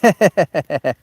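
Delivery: short real laugh; amused chuckle. Real, not acted. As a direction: chuckle at the joke. Laughing at joke.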